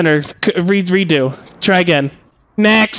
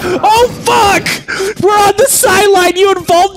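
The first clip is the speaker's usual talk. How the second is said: shouting; 6 semitones higher than usual. Real, not acted. Panicked shout at players.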